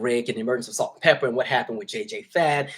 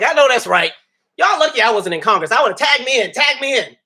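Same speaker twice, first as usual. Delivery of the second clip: high, loud, sassy and fired up; sassy excited outburst; 10 semitones higher, 9 dB louder than usual. Retold or imitated. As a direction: belt it out like ready to fight. Acting out a woman's outburst.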